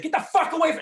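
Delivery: high, sharp threatening yell; a cop yelling a threat. Retold or imitated. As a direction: bark it at the man with the knife. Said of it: "I started yelling at Liam Neeson".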